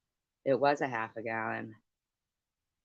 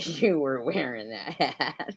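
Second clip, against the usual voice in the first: laughing; slower than usual. Retold or imitated. Retelling group's reply, laughing.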